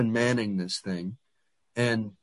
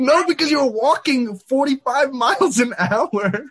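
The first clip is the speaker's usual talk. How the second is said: high, loud, indignant comeback; indignant protest; 14 semitones higher, 6 dB louder than usual. Real, not acted. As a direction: fire back loudly, voice raised high. Heated comeback in friendly argument.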